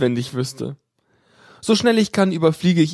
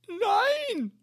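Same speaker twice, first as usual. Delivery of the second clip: much higher, cried out despairing Nein; crying no; 8 dB quieter than usual. A narrator's reading. Caller wailing at the admin over lost warranty, crying.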